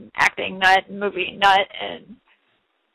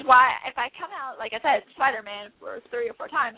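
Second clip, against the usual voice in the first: usual speaking voice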